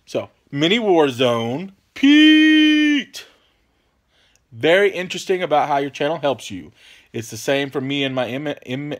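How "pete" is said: high, loud shout of "Pete!"; calling out. Real, not acted. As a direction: call "Pete!" across the room. Calling out a viewer's name.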